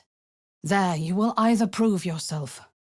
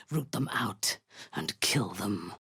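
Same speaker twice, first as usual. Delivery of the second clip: soft, lower, deadly command; whispered kill order; 8 semitones lower, 6 dB quieter than usual. Acted. Drow commander ordering the druids killed, whispered. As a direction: hiss the order quietly.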